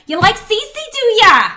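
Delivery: high, loud and slow put-on lady voice; big showy character voice, drawn out; Cece alter ego. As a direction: crow it out as your sassy alter ego. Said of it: "Cece is my second favorite alter ego".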